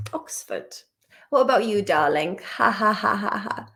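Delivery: ordinary talk, nothing marked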